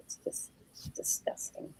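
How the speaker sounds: barely audible, whispered aside; hushed mock disgust. Real, not acted. Marveling at her friends' talent, hushed mock disgust. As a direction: drop to a whisper in mock envy.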